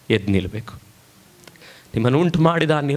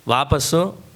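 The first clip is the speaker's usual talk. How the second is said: ordinary delivery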